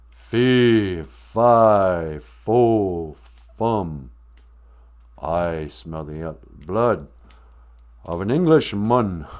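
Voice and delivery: put-on booming giant with an English accent; slow, spaced-out English-accented giant chant. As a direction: boom each word slowly in a mock English accent. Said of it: "Why do you have an English accent".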